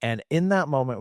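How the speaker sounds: ordinary talk, nothing marked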